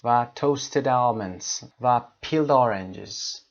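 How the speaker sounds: toasted almonds, peeled oranges slow and joined; word pairs said as one word; slow linked demo. Shown as a demonstration. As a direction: link each pair slowly. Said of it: "peeled به این شکل یک کلمه".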